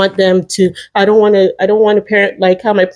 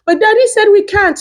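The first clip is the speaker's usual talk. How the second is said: put-on child voice; 11 semitones higher than usual. Kid voice in retold phone call.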